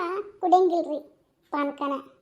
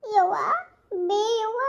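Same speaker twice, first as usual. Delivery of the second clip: calling out; 6 semitones higher, slower than usual. Acted. Calling mother loudly.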